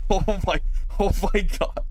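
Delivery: high, broken gasps after laughing; real laughter spilling into the words; overcome with laughter. Real, not acted. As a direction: still cracking up, words squeezed out high. Still reeling from long laugh.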